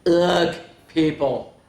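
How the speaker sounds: slow, disgusted scoff; grumpy tree scoffing at children. Acted. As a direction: sneer at the approaching kids.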